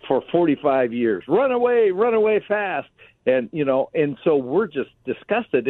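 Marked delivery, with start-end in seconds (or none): 1.3-2.9 lightly hammed-up, high-pitched cry of retreat; high mock call to flee; comic movie quote. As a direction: quote a film's funny line in a raised voice. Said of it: "one of the funniest lines is what".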